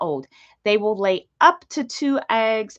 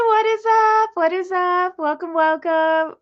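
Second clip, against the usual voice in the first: high, sing-out hello; excited greeting; 9 semitones higher than usual. Real, not acted. Streamer greets arriving viewers, excited and high.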